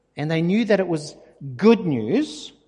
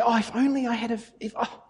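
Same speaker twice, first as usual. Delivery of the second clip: higher, sighing regret over missed chances; mock regret; 6 semitones higher than usual. Preacher mimics believers' regret, mock wistful. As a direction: play the wistful what-if, pitched up. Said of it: "Most times we say".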